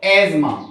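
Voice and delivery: एजमा as "ez-ma", TH silent; correct pronunciation. Shown as a demonstration. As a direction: say एजमा firmly with a Z sound. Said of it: "क्या बोलते हैं इसको".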